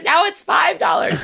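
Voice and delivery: high laughing shout about the price; mock indignant laughing yelp; laughing outrage. Real, not acted. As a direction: squeal it through a laugh.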